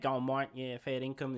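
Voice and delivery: playful send-up of a true-blue Aussie bloke; broad Aussie bloke. Retold or imitated. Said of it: "they talk like that".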